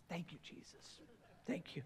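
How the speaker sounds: whispered thanks, barely voiced; hushed whispered thanks. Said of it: "some of you guys are like".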